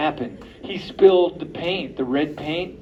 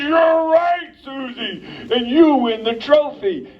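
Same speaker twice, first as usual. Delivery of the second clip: teacher praising; 8 semitones higher than usual. Acted.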